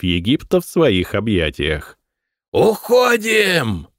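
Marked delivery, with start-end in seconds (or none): 2.5-3.9 slow, much higher drawn-out yell; desperate yell. A narrator's reading. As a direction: scream the retreat across the field. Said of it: "орал Айсын".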